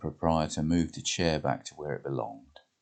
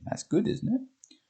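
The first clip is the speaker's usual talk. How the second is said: Jane's voice; slower than usual. Acted. Jane impressed.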